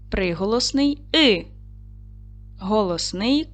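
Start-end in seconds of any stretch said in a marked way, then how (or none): 1.1-1.4 "И" as a lone vowel; short, separate "И"; isolated sound. Shown as a demonstration. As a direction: sound out "И" by itself. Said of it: "виконаємо звуковий аналіз слова".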